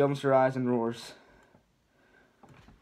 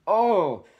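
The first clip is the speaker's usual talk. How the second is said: pained cry; 8 semitones higher, 8 dB louder than usual. Acted. Shrek hit in the groin.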